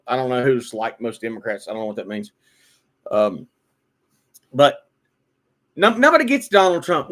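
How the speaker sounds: ordinary talk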